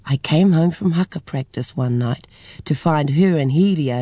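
ordinary delivery, nothing marked

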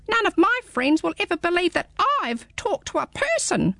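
high, small squeaky creature; a tiny curious hedgehog peering up; high little hedgehog, puzzled. Acted. Hedgehog speaking.

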